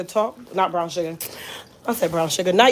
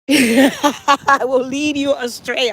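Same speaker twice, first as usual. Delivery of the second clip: slow, drawn-out words through laughter; laughing; 3 semitones higher, much slower than usual. Real, not acted. Warning viewers not to follow her, laughing.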